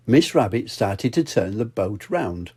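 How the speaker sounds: usual speaking voice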